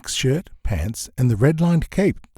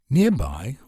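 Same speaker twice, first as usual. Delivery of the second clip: usual speaking voice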